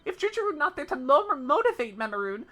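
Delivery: a little beast waving it off; protesting creature. Acted. Memorin refusing the shop, high creature protest.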